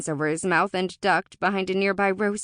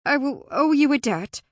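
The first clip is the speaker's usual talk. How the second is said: higher, louder, halting offer; tripping over words; 6 semitones higher, 6 dB louder than usual. A narrator's reading. Alora offers the Fae a favour, tripping over her words. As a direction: stumble into the offer. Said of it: "Alora still tripped over her next words".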